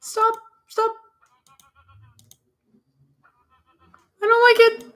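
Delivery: put-on high, loud frightened kid; a little kid yelling stop in fear; scared child crying out. A frightened child shouting at the spooky store, high and loud.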